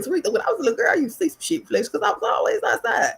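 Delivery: high, light retelling of a relative's voice; mimicking an older relative. Older relative telling Tariq about her childhood, in a high voice. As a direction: lifts the voice high to play the relative. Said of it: "They said".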